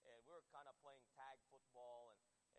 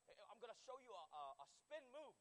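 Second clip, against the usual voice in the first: lightly imitated Australian accent, raised pitch; a hint of an Australian buddy showing off; 6 semitones higher, 4 dB louder than usual. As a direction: put on a mild Australian accent, a bit higher. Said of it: "he had an Australian accent".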